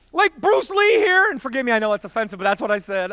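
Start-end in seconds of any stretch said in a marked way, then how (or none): 0.1-1.3 loud, high, slowed acted complaint; indignant yelling. Young man blaming stranger to his buddy, indignant high yell. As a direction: shout the accusation, stretched out.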